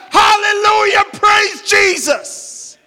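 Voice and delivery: high, drawn-out shouted praise. Acted. Old man after reading the verse, shouting praise. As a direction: shout it high and stretch the last words. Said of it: "And the old man shouted".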